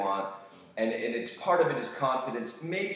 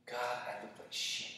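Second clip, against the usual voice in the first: soft, deflated grumble to yourself; dejected self-disgust; 10 dB quieter than usual. Retold or imitated. Man judging his own body last week, dejected. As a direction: let the voice drop away, discouraged.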